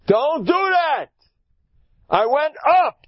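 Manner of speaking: very high, loud, drawn-out cry; high-pitched, forceful exhortation; emphatic rallying shout. Acted. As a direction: shout down the defeatism. Shouts down the defeatist line.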